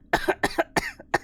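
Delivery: a long, high, rasping fit of coughing; acted coughing fit. Fortunato, drunk in the damp vaults, coughs helplessly. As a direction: hack away as if you cannot stop.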